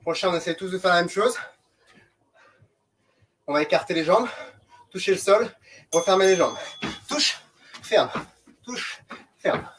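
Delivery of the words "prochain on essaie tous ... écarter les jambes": ordinary delivery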